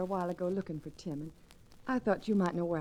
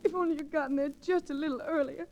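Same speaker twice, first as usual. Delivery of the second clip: high, breaking voice of grief; she's just lost her brother and can't hold it together; grief-stricken; 8 semitones higher than usual. Acted. Sister grieving her brother who was shot, distraught and tearful. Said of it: "you've got to try to get hold of yourself".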